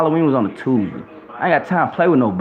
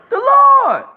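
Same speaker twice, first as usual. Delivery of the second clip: much higher, louder, piercing cry; shrieked proclamation; 5 dB louder than usual. Real, not acted. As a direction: hurl the name out at the top of the voice. Preacher repeating, climbing in fervour.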